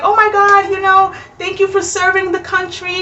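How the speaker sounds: high, gushing thank-you; gushing clerk. Retold or imitated. Clerk thanks husband for serving, high gushing voice. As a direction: fawn over him like a hero. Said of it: "She's like".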